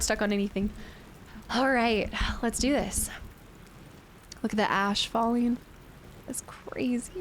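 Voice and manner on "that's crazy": soft unvoiced whisper of awe; whispered amazement; awed whisper. Real, not acted. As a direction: breathe it out in wonder. Awed by ash falling in game.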